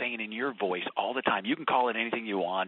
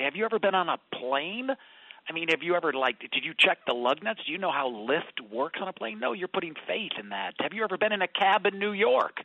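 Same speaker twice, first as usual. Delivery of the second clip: animated challenge; 6 semitones higher, 4 dB louder than usual. Retold or imitated. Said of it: "And I say".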